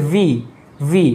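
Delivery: "ভি" as vee, with a buzzing v; English V sound. Shown as a demonstration. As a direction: teeth on lip for "ভি", vee. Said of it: "কিন্তু হবে ভি". ("ভি" ইংরেজি v ধ্বনিতে)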